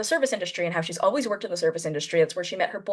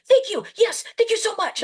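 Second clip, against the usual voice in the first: high, eager mock Tom thanks; gushing Tom; 13 semitones higher than usual. Imitating Tom thanking her again, high and eager. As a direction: squeal the thank-yous up high. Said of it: "He's like".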